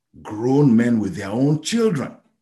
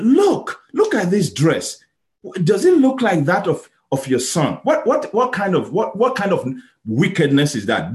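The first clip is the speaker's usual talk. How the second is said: accusing brothers; 9 semitones higher, 5 dB louder than usual. Acted. Brothers confront aging father.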